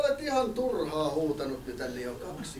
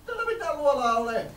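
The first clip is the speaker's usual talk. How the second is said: flustered villager woman; 6 semitones higher than usual. Acted. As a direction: squeal in a panic like a fussy villager. Annoyed villager.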